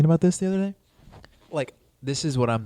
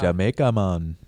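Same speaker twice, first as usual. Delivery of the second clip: put-on Jamaican accent; 6 semitones lower, 3 dB louder, slower than usual. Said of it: "doing my Jamaican accent".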